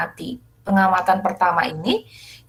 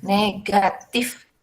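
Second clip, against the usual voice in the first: negatif drawn out very slowly and lightly, rising at the end; teacher drawing out negatif so the class reads it correctly; slow stressed word; 4 dB quieter, much slower than usual. Shown as a demonstration. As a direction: spell out negatif slowly so pupils catch it. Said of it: "jadi itu dibacanya adalah".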